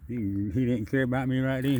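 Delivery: ordinary talk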